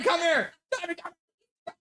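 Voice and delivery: called out high across the house; high calling voice, not close to the mic. Real, not acted. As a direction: call someone from another room. Calling Tommy from afar.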